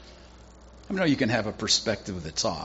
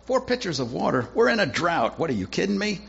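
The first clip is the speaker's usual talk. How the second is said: incredulous protest; 8 semitones higher, 4 dB louder than usual. Acted. Servants balk at wasting water.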